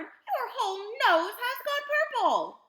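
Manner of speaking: high, loud alarmed shout. A narrator's reading. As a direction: shout it at full voice. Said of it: "shouted Mister Beauregard".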